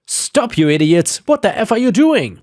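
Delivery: yelling, pitch jumping high; furious shout. Acted. Homeowner screaming at the crew cutting his oak. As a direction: scream at the tree crew to stop. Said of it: "I ran outside screaming".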